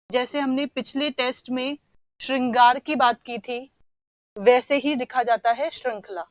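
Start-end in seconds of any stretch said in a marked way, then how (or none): none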